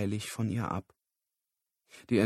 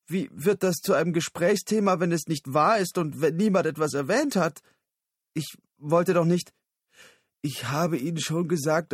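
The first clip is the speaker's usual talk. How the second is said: höher und lauter, gekränkt schimpfend; gekränkte Tirade; 6 semitones higher, 4 dB louder than usual. Acted. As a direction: schimpf gekränkt immer weiter. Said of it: "die tiefe, erstickte Tirade". (Susan schimpft gekränkt und laut auf Lyle ein)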